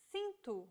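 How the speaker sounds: cinto with the C as an S sound, SIN-to; soft C demo. Shown as a demonstration. Teacher shows the soft C sound to learners. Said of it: "or like this".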